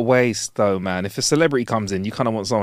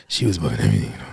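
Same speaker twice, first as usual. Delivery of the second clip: drops way low to mimic a gravelly voice; low, rough mock impression of a friend; gravelly voice imitation; 14 semitones lower, faster than usual. Mimicking his cohost's voice, deep and rough. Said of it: "the gravitas and gravel in your voice".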